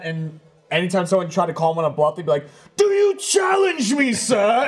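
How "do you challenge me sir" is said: high, slow, shouted challenge; old-timey voice. Retold or imitated. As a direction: challenge him like a pompous gentleman. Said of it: "talking in that old-timey voice".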